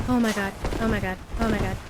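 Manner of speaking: ordinary delivery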